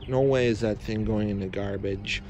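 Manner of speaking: ordinary talk, nothing marked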